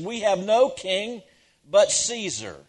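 loud, high, slow declaration; crowd crying out. Retold or imitated. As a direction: cry it out slowly as the accusing crowd. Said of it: "they cry out".

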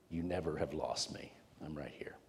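fades from soft to a whisper; soft, tender, down to a hush. Acted. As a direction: reassure her in a whisper. Jesus comforts Mary, fading to whisper.

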